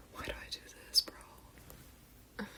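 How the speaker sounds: barely audible whisper to oneself; hushed aside after an awkward moment; whispered self-reproach. Real, not acted. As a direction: whisper it under your breath, embarrassed. Chides herself after singing.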